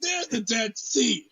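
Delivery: high-pitched, hammed-up textbook read-aloud; over-the-top eager classroom reader; super-enthused reading. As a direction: read dry history as if it thrills you.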